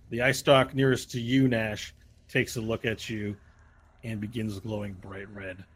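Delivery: ordinary delivery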